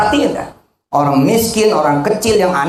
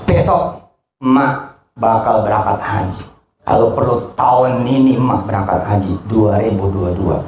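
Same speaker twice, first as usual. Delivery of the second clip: tender promise to mother; 6 semitones lower than usual. Retold or imitated. Child promising mother the hajj, low and tender. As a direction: speak it gently, like a child vowing to a mother. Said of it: "Bilang sama dia".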